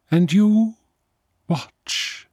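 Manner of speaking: high otherworldly voice sinking to a whisper; vampire fading to whisper. A narrator's reading. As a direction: let the last word vanish into breath. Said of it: "vampire voice ends in whisper".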